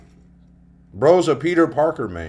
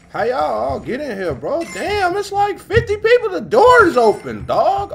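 much higher, shrieking in disbelief at the crowd; shocked outburst; 14 semitones higher, 3 dB louder than usual. Real, not acted. Streamer finding his room full of players, high shocked yelps. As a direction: squeal it out, overwhelmed by how many got in.

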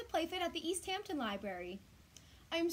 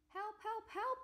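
small, high, soft plea; mock cry for help; 8 semitones higher, 5 dB quieter, slower than usual. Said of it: "cried the Little Blue Truck".

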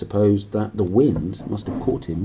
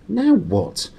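sharp, higher, angry demand; furious snap; 11 semitones higher, 4 dB louder than usual. A narrator's reading. Aunt snapping furiously at the boy. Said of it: "looking furiously at Harry".

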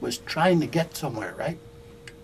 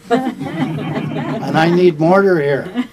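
laughing; 5 semitones higher, 5 dB louder, much slower than usual. Real, not acted. Laughing at his own punchline.

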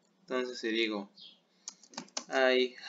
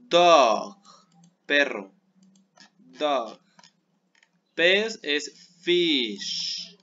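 slow teaching; much slower than usual. Shown as a demonstration. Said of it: "teacher models word slowly".

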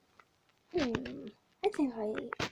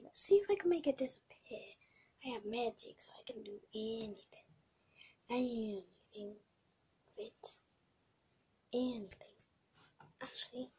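whispering; 2 semitones lower, 5 dB quieter than usual. Pretend. Whispered magic show.

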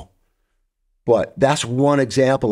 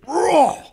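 a high roaring deer call, imitating a buck; mock buck roar cutting through the woods; imitated buck roar. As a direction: let out a buck's roar like on a hunt. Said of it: "did one of those".

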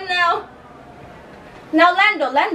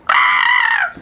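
short scream; 4 dB louder than usual. Real, not acted. Sudden scream at end.

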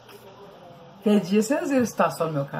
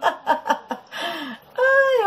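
high giggle; 12 semitones higher than usual. Real, not acted. Laughs while showing hair.

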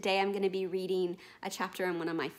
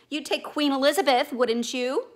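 loud, higher, pushy boatman; 7 semitones higher, 10 dB louder than usual. Acted. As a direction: corner the clerk with it. Boatman presses the clerk.

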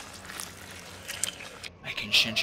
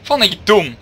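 frustrated shout at himself; angry; 6 semitones higher, 9 dB louder than usual. Real, not acted. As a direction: yell it, annoyed. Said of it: "repeats shouted".

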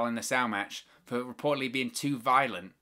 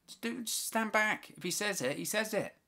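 exasperated; 9 semitones higher than usual. Real, not acted. Commentator pleading with the referee to stand back, exasperated and higher.

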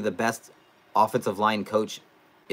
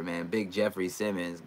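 ordinary talk

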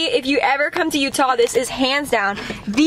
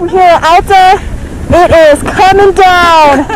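loud, raised-pitch calling out; excited shouting; 5 semitones higher, 12 dB louder than usual. Real, not acted. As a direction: shout it over the snowfall. Calling out in falling snow.

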